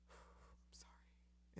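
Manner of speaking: very slow whisper after a sigh; reluctant whispered sorry. Retold or imitated. Mother forcing an apology against her pride, whispered. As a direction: force out the apology.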